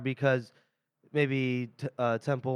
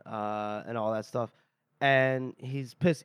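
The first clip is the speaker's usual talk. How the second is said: normal talking voice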